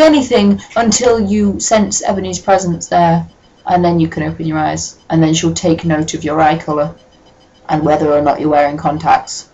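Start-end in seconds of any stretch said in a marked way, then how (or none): none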